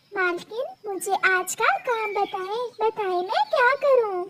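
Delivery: small high soft bird voice; timid little bird. Acted. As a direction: ask meekly, like a tiny servant girl. Little bird asks mistress for work.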